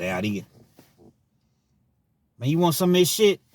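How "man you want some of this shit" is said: high, loud mock inmate offer; imitating how inmates talk, pitched up; hammed-up prison buddy offering food; prison talk imitation. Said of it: "That's how they talk".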